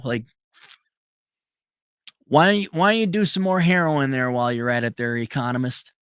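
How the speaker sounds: ordinary talk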